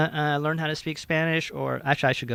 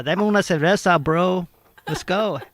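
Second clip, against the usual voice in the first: loud, higher, playful California bro; Californian Spanglish bro; 4 semitones higher, 5 dB louder than usual. Acted. Joking about embracing California roots, loud Spanglish bro. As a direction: order like a hammed-up Spanglish bro. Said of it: "Hey, I'm a California".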